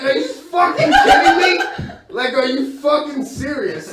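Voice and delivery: high, loud laughing outburst; outraged disbelief. Retold or imitated. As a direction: explode in disbelief while laughing. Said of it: "I'm like".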